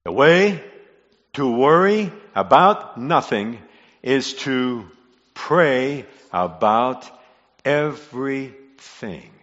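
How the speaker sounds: word by word with deliberate pauses; slow, spaced emphasis. Real, not acted. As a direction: spell it out slowly, phrase by phrase. Repeats key line word by word.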